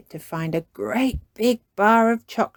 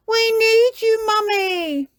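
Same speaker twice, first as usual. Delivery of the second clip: high, loud plea; crying out; 6 dB louder, slower than usual. A narrator's reading. Peppa begs Mummy for help, high loud cry. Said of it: "cried Peppa".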